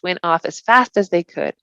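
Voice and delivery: ordinary delivery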